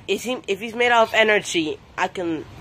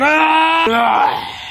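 high, loud battle yell; power-up yell; 7 semitones higher, 3 dB louder than usual. Pretend. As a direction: scream as the power surges. Power-up scream.

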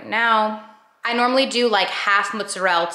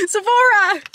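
laughing; 12 semitones higher, 5 dB louder, slower than usual. Real, not acted. Giggly plea to husband.

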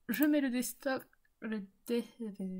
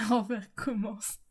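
ordinary delivery

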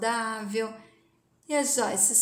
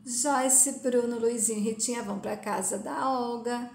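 ordinary delivery

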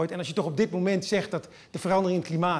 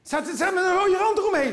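high, insistent protest; protesting inner voice; 10 semitones higher, 4 dB louder than usual. Acted. Driver's inner voice defending the speed limit, high protest. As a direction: argue it back loudly, pitched way up. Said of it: "stemmetje in je hoofd die zegt".

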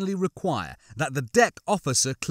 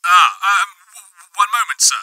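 loud, higher, stammering radio voice; flustered over comm; 5 semitones higher, 10 dB louder than usual. A narrator's reading. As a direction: stumble nervously through a comm speaker. Flustered officer answering over the comm.

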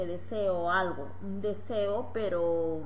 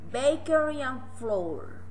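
slow model phrase; 4 semitones higher, 5 dB louder, slower than usual. Shown as a demonstration. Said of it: "Repeat again".

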